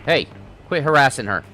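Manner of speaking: loud, heated street-fight yelling; furious tough-guy threats; angry shouting. Acted. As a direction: square up and shout him down. Street fight confrontation acted out.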